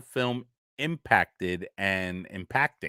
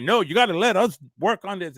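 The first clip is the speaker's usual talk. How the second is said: higher, insistent sheriff pleading his case; sheriff insisting; 9 semitones higher, 5 dB louder than usual. Retold or imitated. Sheriff tells the mob to let police work, insistent higher voice. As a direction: sheriff pushing back on the angry crowd. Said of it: "the sheriff was like".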